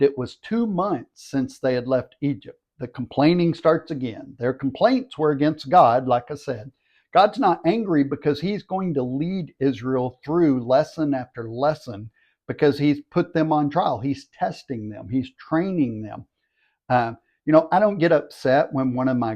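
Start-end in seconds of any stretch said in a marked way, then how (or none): none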